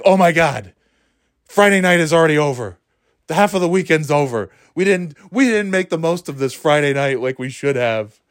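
acted-out fretting, pitched high; mock dismay. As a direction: lament it as if time is slipping away, half-joking. Acts own Friday-night panic.

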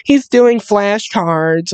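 singsongy mock little girl; imitated little girl. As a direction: report it like a small child, slowly. Voicing little girl reporting back.

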